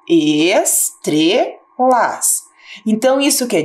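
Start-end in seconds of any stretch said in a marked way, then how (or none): 0.1-1.7 estrelas split slowly into es-tre-las; syllable by syllable. Shown as a demonstration. Teacher showing pupils where a word splits, slowly. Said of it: "olha lá".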